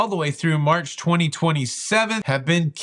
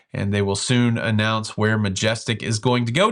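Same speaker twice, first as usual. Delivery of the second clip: ordinary talk, nothing marked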